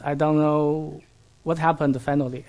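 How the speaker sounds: usual speaking voice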